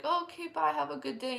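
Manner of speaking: brushing him off sweetly; mock polite goodbye.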